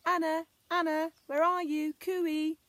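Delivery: high, loud searching call. Acted. Searchers on the road calling for lost Anna. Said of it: "heard a voice crying".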